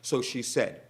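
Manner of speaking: ordinary delivery, nothing marked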